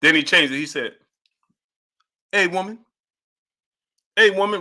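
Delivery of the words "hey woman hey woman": higher-pitched teasing call of hey woman; lightly voiced catcall; mock taunt. Streamer imitates the taunt, mildly mocking. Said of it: "Rocky said".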